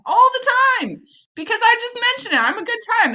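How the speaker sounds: mocking self-praise. Real, not acted. High mocking self-description.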